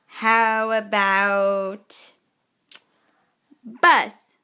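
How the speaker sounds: very slow, stretched prompt. Shown as a demonstration. Mother slowly prompting Gavin to sign bus. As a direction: drag the words out for the child. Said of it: "very slow prompt".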